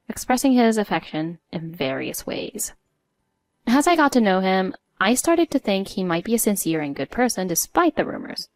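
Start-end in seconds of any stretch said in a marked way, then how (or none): none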